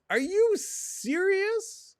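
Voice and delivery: very high, drawn-out squeal of disbelief; incredulous. Real, not acted. Buyer seeing the price of a box, incredulous. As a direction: shoot way up and stretch it out.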